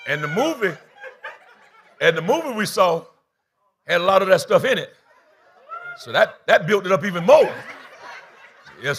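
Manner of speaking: laughing loud through the punchline. Real, not acted. Preacher laughing to the congregation at his own joke. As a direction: chuckle it out, building.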